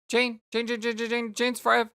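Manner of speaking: high, loud, nagging bestie voice; pestering Roxy. Acted. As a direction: pester her like a bored best friend. Reads Roxy's pestering messages.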